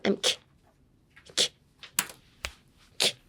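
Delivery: "ki" as a short burst of air from the throat; "ki" as a sharp voiceless click. Shown as a demonstration. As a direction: isolate the K of "ki", no full voice. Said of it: "sound you would make with your throat".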